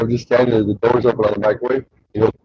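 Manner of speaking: usual speaking voice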